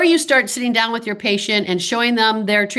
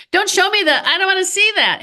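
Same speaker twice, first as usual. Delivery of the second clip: squeamish patient; 11 semitones higher than usual. Retold or imitated.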